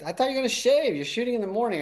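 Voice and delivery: hammed-up reproach about shaving; Nick scolding. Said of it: "he goes".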